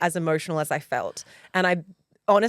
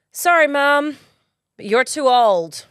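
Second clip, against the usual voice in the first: a touch louder, slow blunt verdict; daughter's deadpan tone; 4 semitones higher, 5 dB louder, slower than usual. Retold or imitated. Daughter telling her mum she is too old, bluntly. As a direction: deliver it like a blunt little kid. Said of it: "literally the tone that she said it in".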